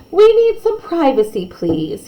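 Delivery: ordinary delivery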